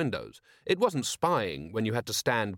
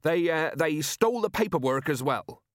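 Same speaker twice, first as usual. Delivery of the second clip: higher-pitched improvised excuse; stumble, then push the excuse out; flustered excuse; 6 semitones higher than usual. A narrator's reading. Merchant covers his lie, flustered. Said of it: "said Jenkins quickly".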